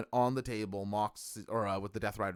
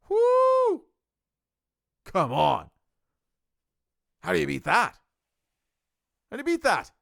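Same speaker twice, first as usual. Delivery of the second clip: real fan whoop, much higher and louder; excited cheering; 10 semitones higher, 10 dB louder than usual. Real, not acted. Wrestling fan cheering the match finish, loud and excited. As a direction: shout out the thrill, can't contain it.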